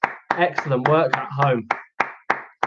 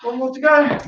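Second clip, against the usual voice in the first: excited; 8 semitones higher than usual. Real, not acted. Sock race finish, excited.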